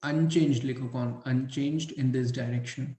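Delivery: slow, measured classroom dictation; word by word, with pauses to write. Acted. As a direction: dictate in short phrases for note-taking. Dictates points for students to write.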